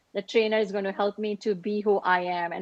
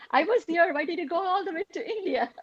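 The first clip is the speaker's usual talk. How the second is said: lightly voiced doctor, higher and teasing; quoting the doctor; 8 semitones higher, faster than usual. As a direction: playfully imitate his gentle scolding. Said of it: "Doctor Clint said".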